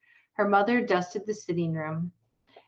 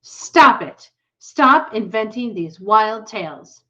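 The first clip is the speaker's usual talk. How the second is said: snapping scold; 3 semitones higher, 11 dB louder, slower than usual. A narrator's reading. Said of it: "snapped her mother".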